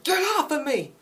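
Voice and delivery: high-pitched acted scream; frantic struggle. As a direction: shriek it like fighting off a grab. Ellie struggling as Joel grabs her.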